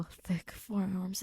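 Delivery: usual speaking voice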